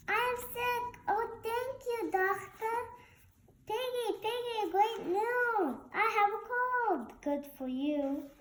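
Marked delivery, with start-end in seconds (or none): 0.1-7.1 high, bright-pitched happy pig voice; cheerful Piggie. Acted. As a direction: play Piggie delighted to be sick.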